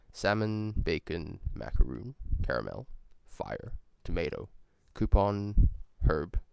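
usual speaking voice

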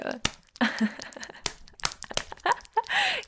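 long, high laugh of disbelief; mocking laugh. Amazed the listener gives in, mocking laugh. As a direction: burst out laughing at them.

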